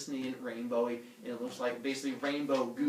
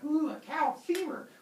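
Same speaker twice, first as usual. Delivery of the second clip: high, drawn-out ooh of wonder; hammed-up fascination, pitched high; mock awe; 13 semitones higher, slower than usual. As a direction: marvel slowly at a prize find. Humans marvel at the bone.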